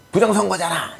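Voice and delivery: 음만 높이 올린 고함 흉내; 따지는 지지자를 흉내 내며 높게 외치기; 지지자의 고함 흉내. Retold or imitated. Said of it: "막 이렇게 소리치고 그랬죠". (높은 목소리로 항의하는 흉내)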